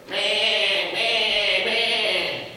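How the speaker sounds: imitated sheep bleats. Imitating the flock coming at a call, bleating.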